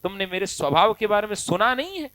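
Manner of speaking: ordinary delivery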